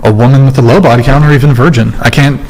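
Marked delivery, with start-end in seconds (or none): none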